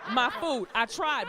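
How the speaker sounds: ordinary talk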